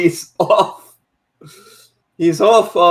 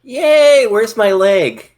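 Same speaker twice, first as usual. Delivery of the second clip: put-on cheerful kid voice; 7 semitones higher, 4 dB louder, slower than usual. Playing the maimed child.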